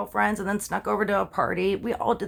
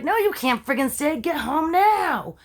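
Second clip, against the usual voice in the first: mock scolding grandma, higher and slow at the end; angry grandma; 7 semitones higher, 4 dB louder than usual. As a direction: imitate a stern grandmother laying down the law. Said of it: "And Grandma's like".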